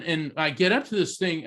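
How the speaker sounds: ordinary delivery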